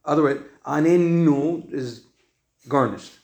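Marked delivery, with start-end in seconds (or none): 0.7-1.7 ANENU with the second n held and doubled; second nun doubled. Shown as a demonstration. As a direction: hold the n before the last vowel, anen-NU. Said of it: "not the second".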